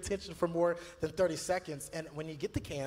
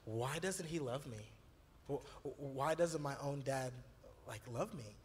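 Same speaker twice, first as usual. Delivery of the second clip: retold crying boy; 3 semitones lower, 7 dB quieter than usual. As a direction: softly echo a heartbroken boy's plea. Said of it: "he's crying and saying".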